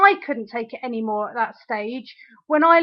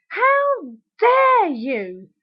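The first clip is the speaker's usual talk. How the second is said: very slow, high-pitched outrage; outraged; 6 semitones higher, much slower than usual. Real, not acted. Addressing the absent ex, real outrage. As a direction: draw each word out in furious indignation. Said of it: "I'm so angry".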